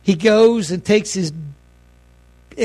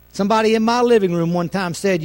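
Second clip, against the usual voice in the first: usual speaking voice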